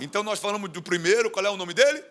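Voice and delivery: normal talking voice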